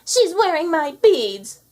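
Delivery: high, loud, slow indignant whine; jealous cry. A narrator's reading. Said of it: "cried Anastasia".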